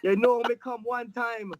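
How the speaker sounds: thick Jamaican patois character voice; Jamaican pirate patois. Pretend. Fighter shows the hosts his Jamaican pirate persona in patois. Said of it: "I've not done this on anybody else's show".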